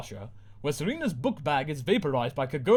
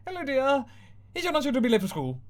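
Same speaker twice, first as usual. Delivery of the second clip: higher and faster, forced cheer; strained TV mum; 12 semitones higher, faster than usual. Acted. Mum on screen repeating herself, strained and rushed. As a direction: rattle it off through a strained smile. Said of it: "Mum smiles, strains".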